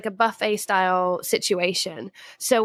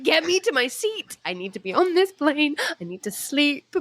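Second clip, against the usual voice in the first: desperate to board; 6 semitones higher than usual. Retold or imitated. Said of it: "I was like".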